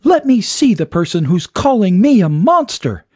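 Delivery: high-pitched indignant demand; high, fierce heroine voice; roaring challenge. A narrator's reading. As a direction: confront the unseen taunter, voice way up. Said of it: "Sparkle Girl roared into the blackness".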